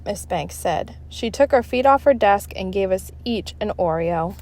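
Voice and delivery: ordinary delivery, nothing marked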